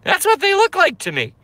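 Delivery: squeaky-high, quick justification; exasperated, fast, very high; high-pitched protest. Real, not acted. Defending his impression afterwards.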